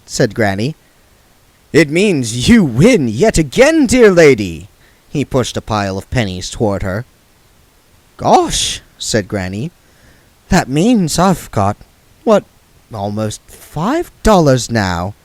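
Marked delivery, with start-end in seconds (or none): 1.7-4.7 slow, lightly voiced smooth gambler; oily card sharp talking down; Mister Frank's voice. 8.2-8.8 high, innocent gasp of wonder; mock surprise. Granny feigning surprise. 10.5-15.1 high, lightly voiced old witch feigning innocence; Granny's voice.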